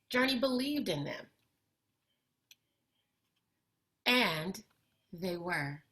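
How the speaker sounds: put-on expressive read-aloud for kids, lively and clear; picture book reading. A narrator's reading. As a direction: bring the story to life for young listeners. Reads picture book to children.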